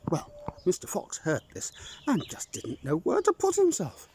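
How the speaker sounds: usual speaking voice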